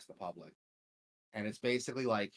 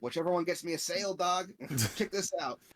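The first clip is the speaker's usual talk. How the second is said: high, loud, quick dealer pitch; upbeat salesman, pitched way up; happy vendor; 9 semitones higher, 6 dB louder than usual. Retold or imitated. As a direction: imitate an eager merchant showing off. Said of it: "he's, like, so happy".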